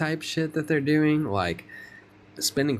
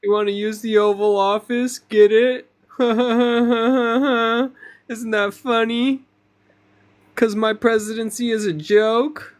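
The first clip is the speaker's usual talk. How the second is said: mocking president; 9 semitones higher, 5 dB louder than usual.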